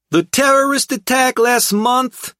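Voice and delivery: high, loud brash thug talking down; condescending. A narrator's reading. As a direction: talk down to an idiot. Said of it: "as if I was an idiot".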